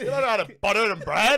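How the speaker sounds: a hint of a scolding Irish mum; imitating Irish mum. Mum scolding her son who can't butter bread, mock telling-off. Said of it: "My little Irish mum be like".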